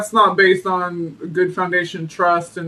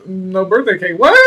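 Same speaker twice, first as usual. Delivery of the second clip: ordinary talk, nothing marked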